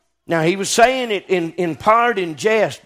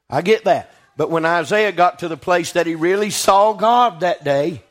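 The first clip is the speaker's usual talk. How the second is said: ordinary delivery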